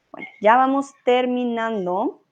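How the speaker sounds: normal talking voice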